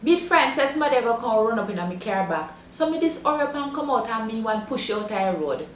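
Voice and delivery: broad Jamaican patois storytelling. Shown as a demonstration. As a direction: tell it in straight Jamaican patois. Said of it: "listen to a straight patois version".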